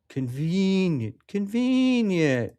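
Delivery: high, drawn-out sarcastic singsong; mocking sarcasm. Real, not acted. Host doubting someone's convenient excuse, mocking sarcasm.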